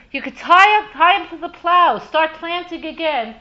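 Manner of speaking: loud, high call to a farmer; acted urgent announcement. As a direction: call out the good news, easing off at the end.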